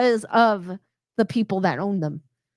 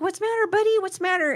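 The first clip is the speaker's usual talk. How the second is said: put-on much higher, gentle baby voice for a dog; cooing pet talk; 8 semitones higher, faster than usual. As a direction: coax him out with a high coo. Coaxing the dog to roll over.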